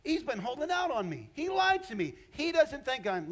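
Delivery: indignant Eve. Acted. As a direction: burst out, betrayed and indignant. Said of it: "And all of a sudden she goes".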